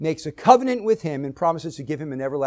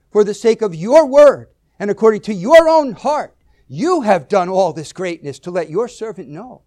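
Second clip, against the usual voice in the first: "your" and "you" driven out high and loud; stress on YOUR and YOU; 4 semitones higher, 9 dB louder than usual. Shown as a demonstration. Preacher showing what jumps off the page, "your" and "you" stressed. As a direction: hammer every "your" and "you". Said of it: "put emphasis on certain words".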